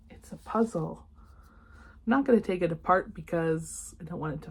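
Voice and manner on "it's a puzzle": delighted unvoiced whisper. Real, not acted. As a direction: whisper the surprise. Whispered discovery.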